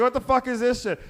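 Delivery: high, loud "what the fuck is this shit"; loud, high disgusted outburst; disgusted exec. Retold or imitated. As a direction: spit it out at the band. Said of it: "It's like".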